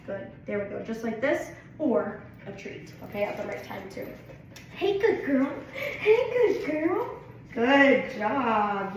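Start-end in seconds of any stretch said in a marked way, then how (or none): 4.7-8.9 bright, high, laughing praise for the dog; high happy dog praise. Real, not acted. Trainer praising her dog, high and delighted. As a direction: reward her with a squeaky happy voice.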